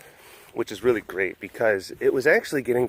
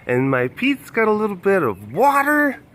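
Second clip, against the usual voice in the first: high, loud, slow pretend cat voice; voicing the cat; 8 semitones higher, 5 dB louder than usual. Keeper speaking for the cat about wet toes, high slow pretend voice. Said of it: "She's like".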